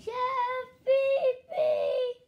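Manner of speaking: put-on high, whiny kid voice; high kid voice calling the chef; Junior calling. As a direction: call out like a nagging kid.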